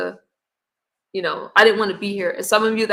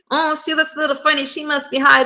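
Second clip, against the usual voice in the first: mock gossiping critic, pitched higher; 6 semitones higher than usual. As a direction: imitate the gossips mockingly, voice up high. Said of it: "There's gonna always say".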